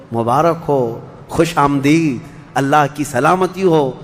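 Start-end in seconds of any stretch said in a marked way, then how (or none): none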